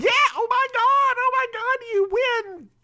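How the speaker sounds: very high, thrilled squeal; excited celebration. Real, not acted. Host celebrates co-host's correct answer, excited high squeal. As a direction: cheer the winner in a high excited voice.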